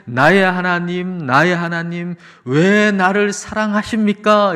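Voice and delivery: high, loud, drawn-out cry to God. Acted. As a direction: call out in overwhelmed wonder. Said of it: "외치고 노래하는 게 가능해졌다".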